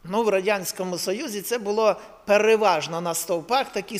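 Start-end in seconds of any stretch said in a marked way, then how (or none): none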